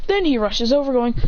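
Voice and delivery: ordinary delivery, nothing marked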